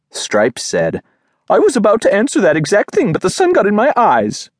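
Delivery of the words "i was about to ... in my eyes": fast, high-pitched dog character; high, rushed, defensive voice; hurried excuse. A narrator's reading. Stripes making a hurried excuse.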